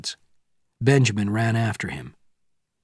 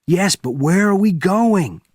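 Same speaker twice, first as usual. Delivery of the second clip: higher, louder urgent shout; 9 semitones higher, 5 dB louder than usual. Acted. Benjamin in the racing jeep, shouting. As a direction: yell it over the engine. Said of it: "Benjamin shouted".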